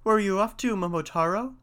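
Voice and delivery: high storybook bird voice; high, lilting question from a pheasant; pheasant asking. A narrator's reading. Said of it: "said the pheasant".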